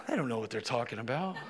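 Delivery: low, quick, soft foolish mock voice; foolish young voice. Preacher voicing foolish young people to the congregation, low mocking mutter. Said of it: "there's this foolish voice that in the young person".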